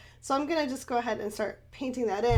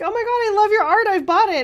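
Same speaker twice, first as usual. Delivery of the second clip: high, loud imitated fan excitement; gushing fan; 11 semitones higher, 9 dB louder, faster than usual. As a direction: gush like a thrilled admirer you are imitating. Acts out fan praising her art.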